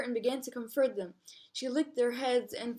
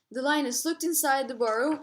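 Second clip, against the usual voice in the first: ordinary delivery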